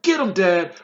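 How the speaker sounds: yell. A narrator's reading. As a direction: egg on the fighting father. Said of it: "There was a yell of".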